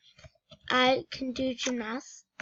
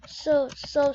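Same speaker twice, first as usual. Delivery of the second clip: ordinary talk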